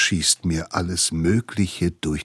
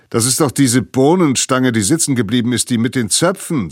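louder, higher, grinning teasing; grinning mockery; 5 semitones higher, 6 dB louder, faster than usual. A narrator's reading. Older brother mocking the girl, grinning and loud. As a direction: tease with a grin in the voice. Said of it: "sagt Tim grinsend".

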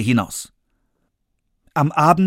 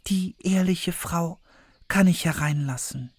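put-on thinking girl; 6 semitones higher, 4 dB quieter than usual. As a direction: think it to yourself, trusting. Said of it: "dachte Schneewittchen".

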